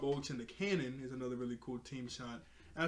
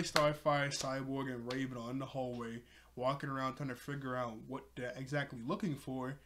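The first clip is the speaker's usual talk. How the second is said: ordinary talk, nothing marked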